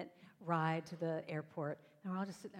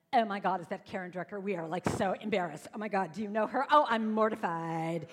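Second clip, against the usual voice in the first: put-on Valley Girl; 9 dB louder than usual. Cool schoolgirls embarrassed by a classmate, mocking Valley Girl. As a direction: do an exaggerated, appalled Valley Girl. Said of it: "doing that Valley Girl thing".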